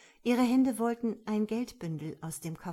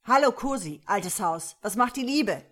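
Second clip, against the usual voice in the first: barking megaphone voice; 2 semitones higher, 9 dB louder than usual. A narrator's reading. Police officer hailing the robber by megaphone, barking. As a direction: bark it out as if through a megaphone. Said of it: "Eine bellende Stimme übers Megafon".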